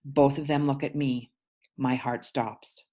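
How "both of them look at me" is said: plain reading voice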